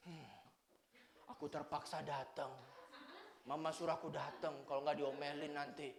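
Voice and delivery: put-on mumbling soft reluctant kid; reluctant child. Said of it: "Datang ke sini".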